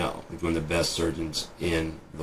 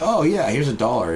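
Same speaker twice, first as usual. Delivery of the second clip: higher, louder breezy mock tourist; oblivious tourist; 7 semitones higher, 5 dB louder than usual. American tourist to foreign cashier, mock breezy and raised voice. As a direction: play the oblivious customer paying up. Imitates tourist paying abroad.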